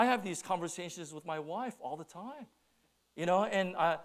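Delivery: normal talking voice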